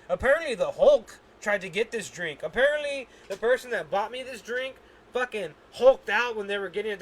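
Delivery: ordinary delivery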